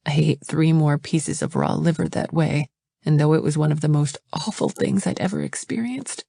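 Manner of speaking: usual speaking voice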